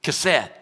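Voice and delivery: kesed said loudly as a careful Hebrew word; Hebrew pronunciation. Shown as a demonstration. Preacher showing how it's pronounced in Hebrew, kesed said loudly. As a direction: give kesed its guttural Hebrew opening, set apart. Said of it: "it's pronounced in Hebrew".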